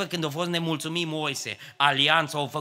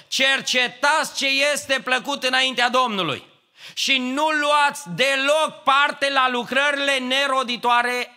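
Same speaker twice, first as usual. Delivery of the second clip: higher, louder pulpit warning; fervent preaching; 8 semitones higher, 5 dB louder than usual. Acted. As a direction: drive the warning home to the church. Preaching at full force. (predică înflăcărată, mai sus și mai tare)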